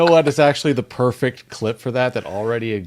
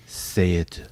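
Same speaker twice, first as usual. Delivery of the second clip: seduce them with the word doucement; smooth narrator voice, low and languid; deep sultry narrator; 6 semitones lower, 5 dB quieter than usual. Acted. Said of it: "You sound like the narrator of SpongeBob".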